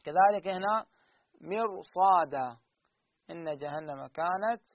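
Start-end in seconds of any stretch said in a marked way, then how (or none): 1.4-2.6 slow recited mirsada with a full, heavy r; teacher draws out مرصادا slowly; Quranic recitation demo. Shown as a demonstration. Teacher slowly recites مرصادا for students. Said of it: "ما أقول".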